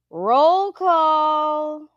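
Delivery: announcer call. Acted. Announcing chat greetings.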